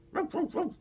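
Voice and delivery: high squeaky puppy yaps; playful dog bark. Pretend. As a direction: yap like a tiny toy dog. Toy dog barking for child.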